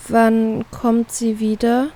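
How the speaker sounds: very slow, hesitant question; slowly. Acted. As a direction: drag each word, sensing bad news. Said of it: "frage ich langsam".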